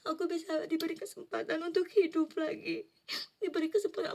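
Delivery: high, tearful prayer of relief; tearful thanks. Retold or imitated. Thanking God after waking, tearful. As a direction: cry out thanks for another chance. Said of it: "Terus aku nangis".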